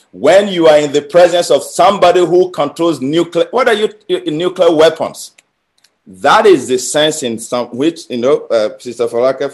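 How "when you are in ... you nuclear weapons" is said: incredulous scorn. Acted. Ridiculing believers guarding God's meeting.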